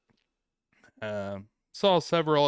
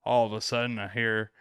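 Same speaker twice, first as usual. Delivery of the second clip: usual speaking voice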